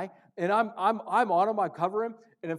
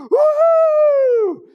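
imitated crowd roaring, very loud and high; a big stadium whoop; 17 dB louder than usual.

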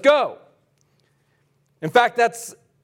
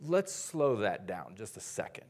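much lower, much softer and calm; God's gentle voice; 9 semitones lower, 12 dB quieter than usual. Retold or imitated. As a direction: voice God slowing them down gently. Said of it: "I kinda hear God saying".

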